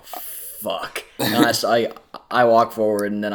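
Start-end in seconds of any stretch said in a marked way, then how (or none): 0.0-1.0 unvoiced whispered swear; whispered curse. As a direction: breathe the curse out. Retold reaction to danger.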